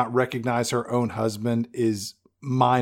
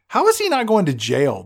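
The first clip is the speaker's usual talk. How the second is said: ordinary talk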